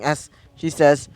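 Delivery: plain reading voice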